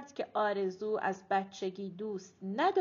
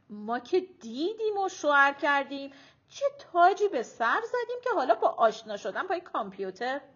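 indignant; 4 semitones higher, 7 dB louder than usual. A narrator's reading. Mother objects to online matchmaking.

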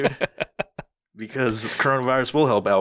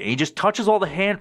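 ordinary talk

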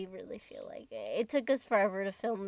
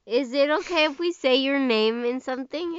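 louder, clear repeat for the phone; raised-voice repeat; 2 semitones higher, 7 dB louder than usual. Real, not acted. Asking a sleepy friend on the phone again, raised voice. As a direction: repeat it loudly for someone who didn't hear. Repeats it after listener says huh.

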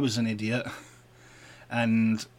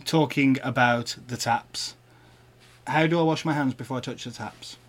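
ordinary talk

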